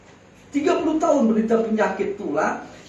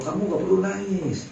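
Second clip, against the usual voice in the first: normal talking voice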